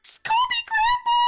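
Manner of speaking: put-on very high, piercing old-lady squeal; shrill granny voice. Old witness introducing herself to the court, in a very high granny squeal. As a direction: screech it like a cackling granny. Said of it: "I'm fucking dying!".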